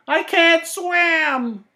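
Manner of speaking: high, very slow desperate wail; panicked cry. A narrator's reading. The old woman calling for help in the coffee, slow high wail. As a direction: stretch out a high frightened wail. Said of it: "she cried".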